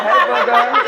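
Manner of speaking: high, playful hey booger; affectionate booger greeting. Retold or imitated. Calling people booger fondly, in a high playful voice. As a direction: tease a friend up high. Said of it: "I'll be like".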